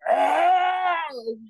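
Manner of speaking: high loud shout of alarm; startled loud cry. Retold or imitated. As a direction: yelp at the rising bear. Bear standing, partner cries out.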